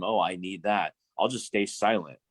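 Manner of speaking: usual speaking voice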